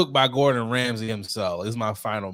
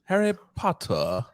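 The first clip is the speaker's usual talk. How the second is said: higher mock British voice; British accent; 4 semitones higher than usual. Shown as a demonstration. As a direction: practise a put-on British accent, pitched up. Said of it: "I'm gonna watch some Harry Potter".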